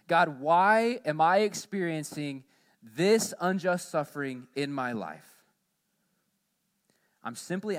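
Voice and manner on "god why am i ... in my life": slow, louder cry of why; anguished plea to God. Pretend. Believer crying out to God over unjust suffering, slow and loud. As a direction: drag out the question in protest. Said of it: "keep asking this question".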